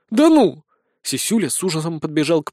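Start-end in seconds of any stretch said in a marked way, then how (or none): 0.1-0.6 loud, high exclamation; shocked disbelief. A narrator's reading. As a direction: blurt out in disbelief. Сисюля в ужасе от находки. (изумлённый возглас)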